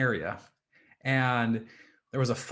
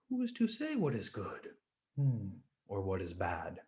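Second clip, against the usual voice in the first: softer, sage-like calm reply; serene old farmer; 4 semitones lower, 7 dB quieter than usual. Acted. Old farmer answers the ecstatic villagers, soft and serene.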